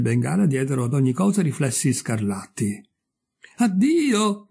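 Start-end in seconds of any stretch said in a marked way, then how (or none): 3.6-4.5 high, called-out goodbye; cried farewell. Acted. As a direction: call out a farewell as you float away. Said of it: "gridò la mongolfiera".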